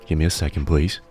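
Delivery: usual speaking voice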